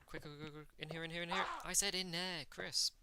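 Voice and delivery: urgent ordering. Pretend. Player ordering Chris through the door, urgent. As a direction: urge the character inside, pitch lifted. Ordering the game character indoors.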